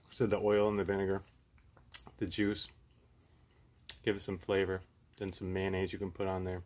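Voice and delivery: chewing between words; muffled talking while eating; mouth full of sandwich. Real, not acted. Seen 0: Bit sandwich at -5; lips closed, chewing while speaking throughout.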